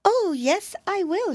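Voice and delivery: put-on high, fretful little girl voice; a tiny frightened mouse wailing; timid mousy girl. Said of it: "she said".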